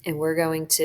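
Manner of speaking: usual speaking voice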